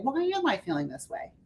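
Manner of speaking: soft, curious and kind to yourself; compassionate. Shown as a demonstration. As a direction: ask yourself gently, with love. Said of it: "your compassionate loving on yourself".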